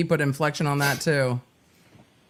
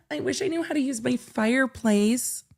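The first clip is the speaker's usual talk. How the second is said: mock dreamy sigh; 7 semitones higher than usual. Mocking his text dreamily.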